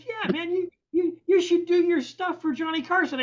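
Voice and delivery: high-pitched mock enthusiasm; imitating eager urging. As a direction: play the engineer egging a friend on. Engineer eagerly urges him to call Carson.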